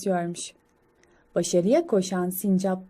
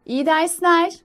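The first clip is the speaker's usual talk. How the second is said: higher, louder parental send-off; parent squirrels; 7 semitones higher, 5 dB louder than usual. Acted. Parents seeing young squirrel off to school, raised well-wishing voice. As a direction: call out a wish as a parent.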